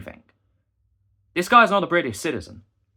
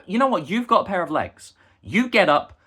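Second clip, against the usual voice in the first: higher angry jab; retold heated jab; 5 semitones higher, 4 dB louder than usual. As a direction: throw it at him in annoyance. What he said, heated.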